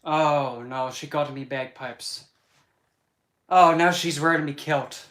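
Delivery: playful Scottish outcry over the kilt; Scottish accent. Pretend. Streamer pretending Nessie stole his kilt, in a loud Scottish accent. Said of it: "to actually do the accent".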